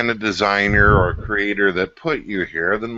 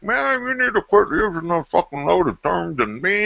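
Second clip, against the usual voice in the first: mocking whiny complaint; 9 semitones higher than usual. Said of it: "you're just like".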